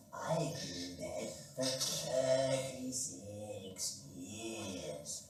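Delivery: put-on slow, drawn-out old man. Old man answering the phone, slow and drawn out. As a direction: drag the words like a tired old man.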